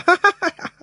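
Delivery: high, hammy booming ha-ha-ha; villain's big laugh. Acted. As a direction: laugh like a smug dragon king. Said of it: "白龙哈哈大笑啊".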